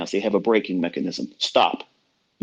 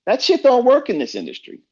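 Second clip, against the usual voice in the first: loud outburst; 13 semitones higher, 4 dB louder than usual. Real, not acted. Ranting at reckless traders.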